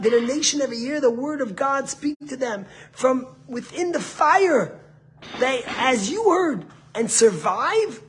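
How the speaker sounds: high, loud, drawn-out scriptural proclamation; impassioned preaching. Acted. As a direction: proclaim the verse with rising fervour. Preaches the verse at full force.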